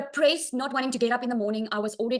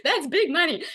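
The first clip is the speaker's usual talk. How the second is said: retold, higher and louder, laughing through it; amazed laughing question; 6 semitones higher, 4 dB louder than usual. Salon owner asking a rich client her job, laughing and amazed. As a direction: replay your own wide-eyed surprise at her spending. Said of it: "And I was like".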